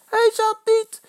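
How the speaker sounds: very high, loud denial; piercingly high yelled protest; shouting. A narrator's reading. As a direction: shriek the denial back. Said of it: "schreeuwt Eddy".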